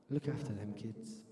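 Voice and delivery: low, hushed parting words; old mum's request. Acted. Old mum's parting request to her son, hushed. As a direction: ask softly, voice sunk low.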